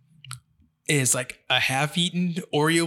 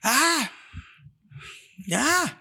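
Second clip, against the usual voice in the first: put-on toddler shout; 13 semitones higher, much slower than usual. The baby yelling at the timeout door, high toddler shout. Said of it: "there's no subtlety".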